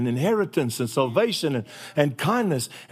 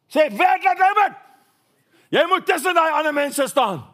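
RSM scolding; 10 semitones higher, 3 dB louder than usual. Retold or imitated. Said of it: "RSM scolding Lambert".

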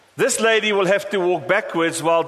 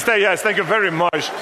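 ordinary talk

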